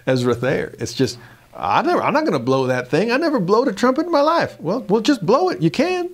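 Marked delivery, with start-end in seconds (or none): 1.5-4.6 hammed-up Southern country accent, rising much higher and faster; country farmer voice. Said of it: "kind of backcountry farmer talk". 4.6-6.1 high, hammed-up country voice urging him on; coaxing messenger. Messenger urging Ezra to blow.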